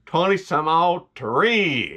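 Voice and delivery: slow, lazy country delivery; slow country drawl. Shown as a demonstration. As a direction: stretch the date out slow, country style. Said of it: "You gotta say it like that".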